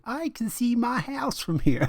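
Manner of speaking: very high-pitched, bad politician impression; Sarah Palin. Mocking a politician for friends, terrible high-voiced impression. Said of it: "Terrible Sarah Palin".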